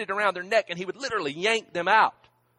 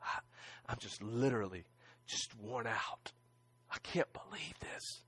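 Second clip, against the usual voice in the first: broken whisper; 9 semitones lower, 17 dB quieter than usual. Retold or imitated. Preacher retelling what he told Kim after grandma died, broken whisper. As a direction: fade to a stunned, exhausted near-whisper. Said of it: "telling Kim".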